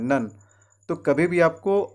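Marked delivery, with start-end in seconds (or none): 0.0-0.3 "नन" with a short uh vowel; correct syllable. Shown as a demonstration. Teacher repeats what to say after "pra", short "नन". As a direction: say "नन" as English nun, on its own. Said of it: "आपको नन कहना है".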